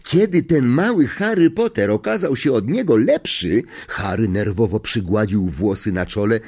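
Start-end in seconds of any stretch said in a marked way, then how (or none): 0.0-3.6 high, lively, slangy character voice; gossipy conductor. Acted.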